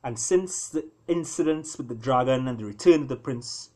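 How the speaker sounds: storyteller reading a fable aloud; story narration. A narrator's reading. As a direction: draw listeners into the tale, unhurried. Author reads novel aloud.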